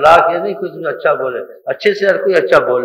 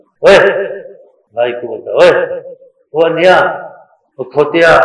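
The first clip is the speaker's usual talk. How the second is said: loud rough "اوئے" bark, higher; harsh angry yelling; 4 semitones higher, 6 dB louder than usual. Shown as a demonstration. Preacher mocks the wrong way, loud angry yelling. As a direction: yell insults at a brother. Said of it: "ایسے نہیں کرنا چاہیے".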